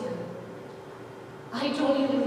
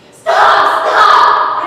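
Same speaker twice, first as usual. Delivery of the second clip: breathless cry, much louder; strained scream; 9 semitones higher, 16 dB louder than usual. A narrator's reading. Narrator trampled under the crowd, strained scream. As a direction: force out a scream while being trampled. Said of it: "I managed to scream".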